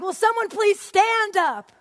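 high, loud, stretched-out plea; yelling. Acted. Teacher begging silent students to stand, yelling. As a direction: belt it out in exasperation. Re-enacts yelling at her class.

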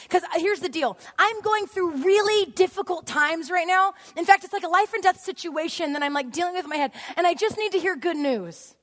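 high, loud impassioned plea; yelling. Acted. Teacher pleading with her class for good news, yelling. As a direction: raise the voice, desperate for good news. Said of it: "I'm yelling at this point".

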